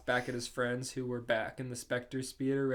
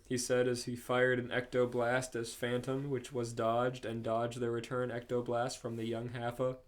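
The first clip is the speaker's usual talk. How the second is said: ordinary delivery, nothing marked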